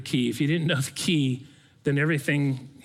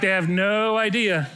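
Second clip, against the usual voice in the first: slow, drawn-out disbelief, louder; exasperated aside; 4 semitones higher, 4 dB louder than usual. Retold or imitated. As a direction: stretch out "idea" in amused exasperation. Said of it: "And I'm like".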